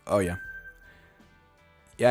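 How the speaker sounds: usual speaking voice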